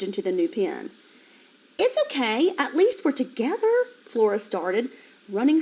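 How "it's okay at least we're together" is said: higher piglet voice, hopeful; lightly voiced hopeful piglet. A narrator's reading. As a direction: reassure everyone brightly. Flora reassures family.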